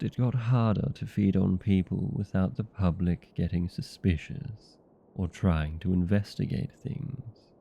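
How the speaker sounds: normal talking voice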